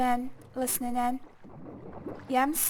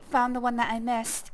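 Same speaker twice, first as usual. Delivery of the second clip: normal talking voice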